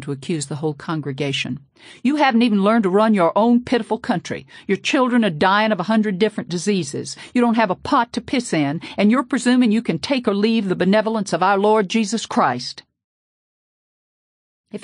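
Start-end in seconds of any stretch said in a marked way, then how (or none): 2.0-12.8 loud, high furious tirade; raging rant. A narrator's reading. Preacher accusing the whole congregation, raging tirade. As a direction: hurl the accusation at the whole room.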